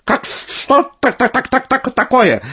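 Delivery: very high flustered hen cackle; high-pitched staccato poultry chatter; clucking, chattering hens. A narrator's reading. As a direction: cluck the words like startled hens. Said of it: "затараторили куры".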